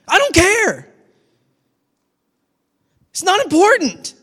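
high, loud, earnest declaration; God insisting he doesn't care about sins. Acted. God's voice quoted, sermon.

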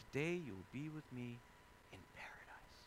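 hushed promise. Retold or imitated. Jesus's promise repeated hushed.

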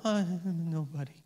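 slow, softly mumbled mock humility; mock self-deprecation. Preacher jokingly playing someone hiding their light, mock self-deprecation. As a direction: shrink away in pretend modesty.

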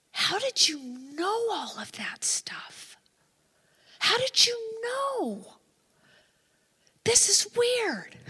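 high, slow, drawn-out disbelief; imitating an astonished woman on the edge of tears; squeaky, stretched mock bewilderment; tearful amazement. Retold woman amazed after crying.

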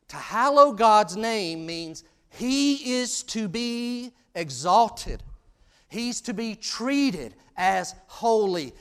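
loud, high preaching with slow, weighty stresses; full-force preaching. Real, not acted. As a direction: proclaim it slowly to the whole congregation.

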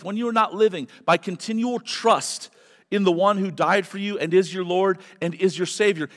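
fervent, loud, high preaching. Acted. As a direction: preach it out to the congregation. Preaching at full force.

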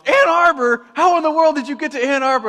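high, loud disbelieving shout; shocked brother. Retold or imitated. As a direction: blurt it out in total disbelief.

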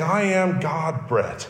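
slow, weighty "God bread", "God light", "God vine"; slow it down and lean on God; deliberate slow paraphrase; slow emphatic. Acted. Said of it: "To put that another way".

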